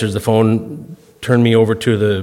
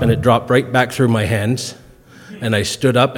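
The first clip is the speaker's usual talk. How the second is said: normal talking voice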